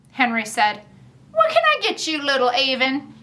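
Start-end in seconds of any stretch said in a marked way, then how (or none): 1.3-3.1 put-on old shopkeeper. Old shopkeeper Henry takes her order.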